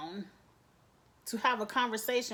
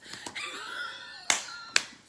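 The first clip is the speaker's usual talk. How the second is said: laughing scream. Real, not acted. Reaction to the bombshell.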